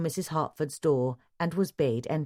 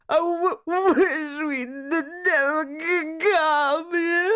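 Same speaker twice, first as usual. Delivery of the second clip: high, loud, slow stuttering wail; 13 semitones higher, 5 dB louder, much slower than usual. A narrator's reading. Distraught wife wailing to her friend about coming here. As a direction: sob out each broken word. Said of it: "wailed Missus Hartford".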